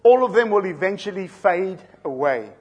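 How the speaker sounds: loud, rising pulpit delivery; preaching at full force. Acted. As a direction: build the sermon to full force. Builds to a loud climax.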